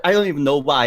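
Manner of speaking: ordinary talk, nothing marked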